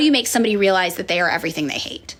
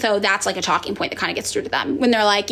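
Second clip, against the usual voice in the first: ordinary talk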